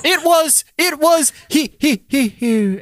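high, strained theatrical death throes; hammed-up dying victim. Acting out a fake death.

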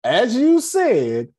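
high, slow, loud "As you said"; slow rising jab of "As you said"; pointed emphasis. Retold or imitated. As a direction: lean hard on every word. Pointed repetition at elders.

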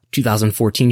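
ordinary talk, nothing marked

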